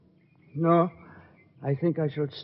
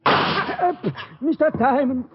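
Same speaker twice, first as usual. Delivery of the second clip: anxious call; 8 semitones higher, slower than usual. Acted. Uncle calls to fallen Diamond.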